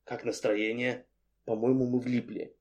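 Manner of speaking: hushed and dry; whisper. A narrator's reading. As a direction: keep it down, nearly whispering. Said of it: "шепнул Антон".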